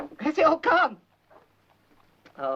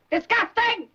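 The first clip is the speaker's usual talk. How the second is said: shrieked repetition; 13 semitones higher, 3 dB louder, slower than usual. Acted. Mother repeats word, louder.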